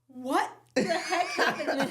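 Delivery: slow, loud, laughing disbelief; shocked mom. Retold or imitated. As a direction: play the stunned mother. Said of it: "she's like".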